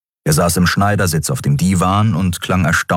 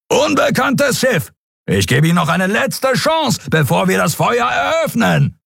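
high, ringing warning to the strangers; stern ship captain. A narrator's reading. Warship hails fleeing ship.

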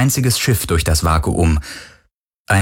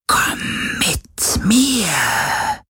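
slow ghostly whisper; eerie plaintive whisper; 12 semitones higher, much slower than usual. Acted. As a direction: lure him with a haunting whisper. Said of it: "klagende Stimme". (klagendes Geisterflüstern)

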